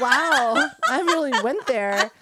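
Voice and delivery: high and loud, laughing through it; laughing mock shock. Mother mocking her own swear in front of daughter, laughing.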